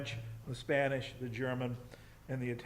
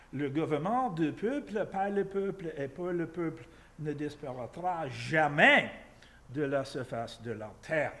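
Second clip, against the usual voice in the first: loud, declaimed French with an English speaker's accent; French reading; 4 semitones higher, 7 dB louder than usual. Shown as a demonstration. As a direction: recite grandly in French. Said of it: "the worst French accent".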